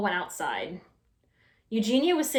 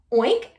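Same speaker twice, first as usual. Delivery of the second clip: questioning oink; 2 semitones higher, 6 dB louder than usual. Acted. Pig asks a question.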